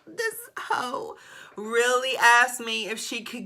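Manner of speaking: high, slow, voice close to breaking; shaken disbelief. Real, not acted. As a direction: still rattled, dragging each word out. Shocked by naked request.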